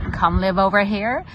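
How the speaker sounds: ordinary delivery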